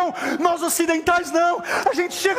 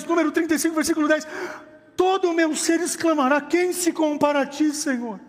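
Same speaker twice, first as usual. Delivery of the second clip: impassioned preaching; 4 semitones higher than usual. Real, not acted. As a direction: preach fervently, driving each phrase to the crowd. Preaches at full force. (pregação fervorosa)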